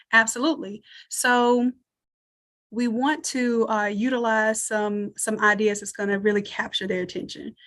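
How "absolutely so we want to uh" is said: normal talking voice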